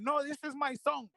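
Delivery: put-on a proud mother defending her son in a Spanish accent. Son imitating his mother telling strangers off, in a Spanish accent. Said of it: "with her Spanish accent".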